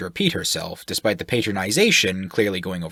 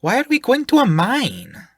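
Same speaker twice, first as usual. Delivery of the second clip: much higher and louder, puzzled; confused; 6 dB louder than usual. Acted. The gnome asks why they go to a mine, confused. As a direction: ask it in baffled surprise. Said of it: "Pip seemed confused".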